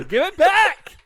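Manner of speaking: high-pitched comic yell; hammed-up yelled demand; mock yell. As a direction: yell it in a high, playful squeal. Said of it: "you have to yell".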